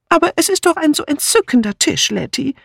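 very high, quick, fussy lady; fretful Dora. A narrator's reading. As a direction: protest fast in a high voice. (aufgeregte Dame, hoch und schnell)

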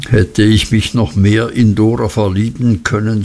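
plain reading voice

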